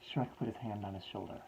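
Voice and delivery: usual speaking voice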